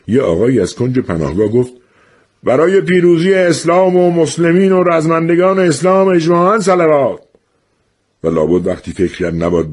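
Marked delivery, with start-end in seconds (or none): 2.4-7.2 loud, high rallying call. Acted. Man in the corner calling the crowd to a salavat, loud and high. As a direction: rouse the whole shelter to chant.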